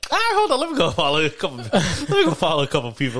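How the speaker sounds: high, laughing, eager to follow. Retold or imitated. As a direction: giggle through it eagerly. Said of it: "I remember I was like".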